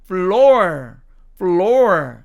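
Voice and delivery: FLOOR with the r sounded; American floor variant. Shown as a demonstration. Teacher showing the other American way, FLOOR with r. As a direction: let FLOOR close on a clear r. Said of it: "미국식 발음으로 하면".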